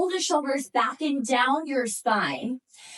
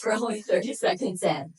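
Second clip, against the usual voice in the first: normal talking voice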